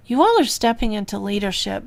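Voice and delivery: ordinary talk, nothing marked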